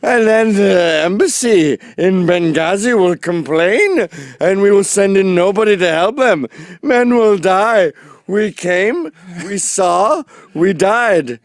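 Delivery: loud, high proclamation; hammed-up politician speech at full volume; mock presidential address. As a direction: declaim it grandly, oblivious and overblown. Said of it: "you're the president of the United States".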